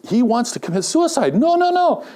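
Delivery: high, urgent inner panic; alarmed at the jumper about to leap; alarmed thought. Retold or imitated. Preacher retelling his alarm at a man about to jump.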